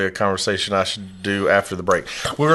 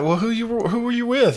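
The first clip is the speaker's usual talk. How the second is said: much higher voice; puzzled retold question; 11 semitones higher than usual. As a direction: recreate a high-pitched query to a friend. Retelling his question to friend.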